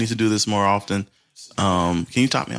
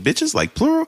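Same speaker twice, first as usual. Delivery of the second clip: high-pitched incredulous question; mock disbelief. Retelling his reply to his cousin's plans, mock disbelief. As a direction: squeak up in mock surprise at each word.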